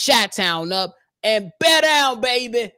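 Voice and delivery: slow, high-pitched hyped sign-off; Marifa Asar. Retold or imitated. Host closing the show in a caller's voice, hyped imitation. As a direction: imitate a caller's drawn-out rallying catchphrase. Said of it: "in my Marifa Asar voice".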